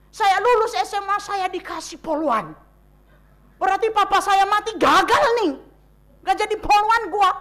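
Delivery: high, loud, heated protest; defiant shout. Retold or imitated. Teenage girl shouting back at relatives, high and loud. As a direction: fight back in grief. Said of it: "Saya lawan".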